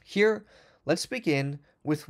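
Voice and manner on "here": ordinary talk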